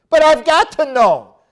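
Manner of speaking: high, loud pleading why; whiny objector. Retold or imitated. Preacher voicing a member demanding reasons, high and whiny. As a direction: whine like a stubborn churchgoer. Mock dialogue with congregant.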